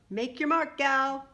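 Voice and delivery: loud, high send-off call. A narrator's reading. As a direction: call it out as you drive away. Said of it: "calling out".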